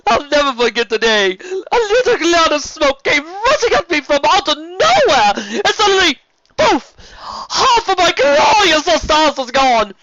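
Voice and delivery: theatrical sea serpent bemoaning cruel fate; dramatic lament. Acted. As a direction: mourn the lost mustache like a tragedy.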